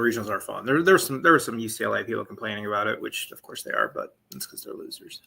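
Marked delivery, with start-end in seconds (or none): 3.0-5.2 much softer whispered put-down; whispered jab. Real, not acted. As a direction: whisper it like a snide aside. Snide aside about UCLA fans.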